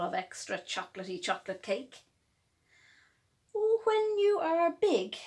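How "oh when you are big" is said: higher fond daddy voice; daddy's voice. Acted. Daddy answering his little bunny, fondly. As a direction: indulgent dad soothing a little one. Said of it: "said his daddy".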